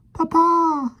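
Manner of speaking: very high squeaky cry of papa; three tiny boys calling out; squeaky children. Acted. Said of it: "cried a trio of squeaky voices".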